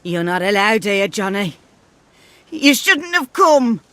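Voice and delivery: put-on loud, fast scolding old woman; angry scolding. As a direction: snap at him to leave. Gran scolds Johnny.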